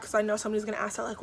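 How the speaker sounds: usual speaking voice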